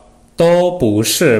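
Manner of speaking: slow, careful Mandarin model phrase; teacher reads it out slowly for students; Mandarin demo. Shown as a demonstration. Teacher models Mandarin phrase slowly and clearly.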